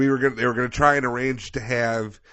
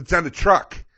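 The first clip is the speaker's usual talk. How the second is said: higher, louder exasperated retort; retold angry outburst; 7 semitones higher, 6 dB louder than usual. Student fed up with UPS staff, angry. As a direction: snap it back, fed up. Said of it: "just getting angry".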